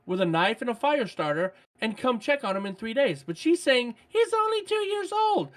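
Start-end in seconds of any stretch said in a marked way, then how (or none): 4.1-5.5 imitated protesting wife.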